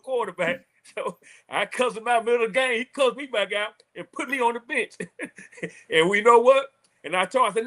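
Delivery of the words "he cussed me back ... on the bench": ordinary talk, nothing marked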